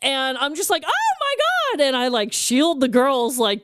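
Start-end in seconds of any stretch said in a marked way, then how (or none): 0.9-1.8 high-pitched acted dismay; retold alarm. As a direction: react aghast, pitch shooting way up. Retells own alarm at brawl.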